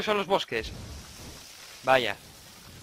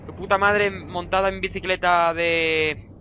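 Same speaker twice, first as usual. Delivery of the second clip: slow drawn-out insult; slower than usual. Acted. Gamer drags out his insult at Slenderman, slowly. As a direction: stretch every word out slowly.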